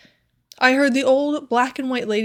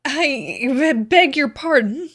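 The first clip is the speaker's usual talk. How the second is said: flustered, stammered apology, higher; mildly shaken, halting and higher; overcome; 4 semitones higher, 5 dB louder, slower than usual. A narrator's reading. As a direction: stumble into a mortified apology. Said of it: "quite overcome".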